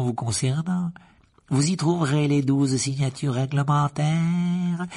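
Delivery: silky menace toward the headmaster; Lucius Malfoy voice. A narrator's reading. Malfoy serves Dumbledore a suspension, coolly superior.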